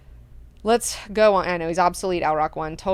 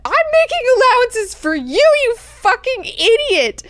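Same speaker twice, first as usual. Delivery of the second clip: very high, loud, outraged yell; furious mock shout; 8 dB louder than usual. [name] putting Duncan in his place, outraged shout. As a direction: yell it high, stretching the insult.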